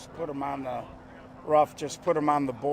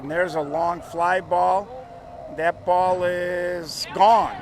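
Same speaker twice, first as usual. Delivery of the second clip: loud, drawn-out home run call; excited home run call; 3 semitones higher, 5 dB louder, slower than usual. Acted. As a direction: stretch out gone like a big call.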